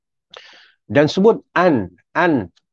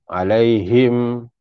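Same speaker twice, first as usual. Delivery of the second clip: usual speaking voice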